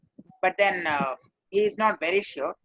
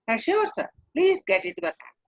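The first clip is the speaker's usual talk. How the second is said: Sure lifted high, then a friendly falling tune; greater warmth; 7 semitones higher than usual. Shown as a demonstration. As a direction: sound glad to have the business. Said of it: "not only a falling tone, but a greater warmth".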